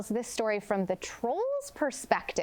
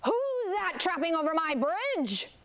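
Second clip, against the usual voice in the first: raised-pitch storybook troll bellow; roaring troll; 7 semitones higher than usual. A narrator's reading. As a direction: voice the troll challenging a trespasser. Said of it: "roared the troll".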